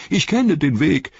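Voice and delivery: high, loud, desperate begging; a cornered coward crying to be let go; Wormtongue pleading. Acted. Wormtongue begs Treebeard in a high, loud voice. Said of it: "sagte Schlangenzunge".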